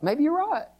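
high, strained doubting voice; doubting Peter. Acted. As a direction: panic rising as faith slips. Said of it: "he probably thought".

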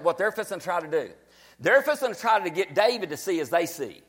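normal talking voice

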